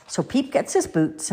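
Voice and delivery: ordinary delivery, nothing marked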